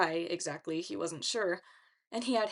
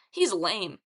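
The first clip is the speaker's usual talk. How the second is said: dismissive taunt; 6 dB louder than usual. A narrator's reading. Leo taunts Narcissus.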